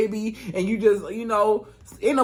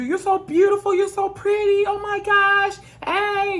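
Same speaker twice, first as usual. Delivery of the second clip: gushing relatives; 12 semitones higher than usual. Retold or imitated. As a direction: fawn over the baby girl. Relatives fussing over a little girl.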